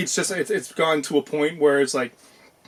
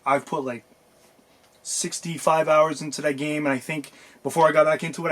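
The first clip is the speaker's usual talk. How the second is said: ordinary delivery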